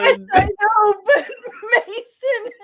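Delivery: high and loud, cracking with laughter; laughing so hard the words crack. Real, not acted. Laughing reply.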